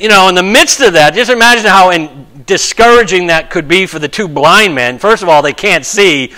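ordinary talk, nothing marked

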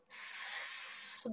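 "ス" as a long hiss without vowel; pitchless voiceless s. Shown as a demonstration. Teacher showing it carries no pitch, "ス" as a sustained hiss. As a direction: let "ス" be pure breath, no voice. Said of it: "音程がつけられない".

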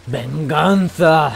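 slow, loud hammed-up cry for revenge; drawn-out vengeance cry. Gamer swearing revenge for the slain sheep, slow loud hammed-up cry. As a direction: stretch the word like an epic avenger.

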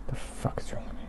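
hushed whisper to himself. Real, not acted. Whispering at himself after saying scary. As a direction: mutter it under your breath, embarrassed.

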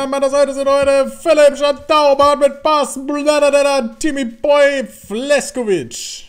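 upbeat channel intro announcer; show host intro. Acted. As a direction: greet the viewers like a show host. Host opens the episode.

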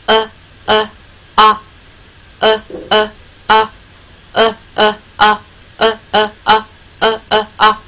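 呃呃啊 chanted slowly in rhythm, mouth opening on 啊; slow rhythmic drill 呃 呃 啊; vowel drill. Shown as a demonstration. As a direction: lead the class through 呃呃啊. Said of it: "我们来一起来试一下啊".

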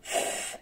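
"F" held as a long puff without voice; breath sound. Shown as a demonstration. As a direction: blow a long "F", no vowel after it. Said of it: "Là, c'est le souffle".

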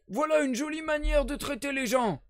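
put-on old sailor protesting at being blocked; indignant cry. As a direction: cry out in protest, stick on the floor. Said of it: "cria-t-il".

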